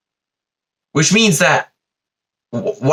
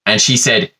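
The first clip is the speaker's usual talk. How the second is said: usual speaking voice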